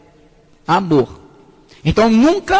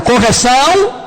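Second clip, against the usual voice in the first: "Correção" called out louder with a rising end; louder repeat; 2 semitones higher, 4 dB louder, slower than usual. Shown as a demonstration. Preacher demands a stronger response, louder. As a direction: push "Correção" harder after calling the crowd weak. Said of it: "Tá fraco?".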